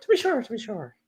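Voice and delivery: high, hammed-up Irish "to be sure"; mock Irish accent. Co-host mocking his Irish friend's accent, hammed-up Irish. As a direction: tease him in a stage Irish accent. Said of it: "It can't do the accent".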